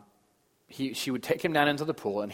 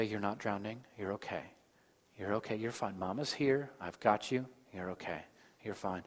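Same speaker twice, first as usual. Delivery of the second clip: calm, soft reassurance; 7 dB quieter than usual. Retold or imitated. Mother to frightened son in the pool, calm and soft. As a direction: imitate a mother soothing her child, hushed. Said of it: "she would speak calmly and softly".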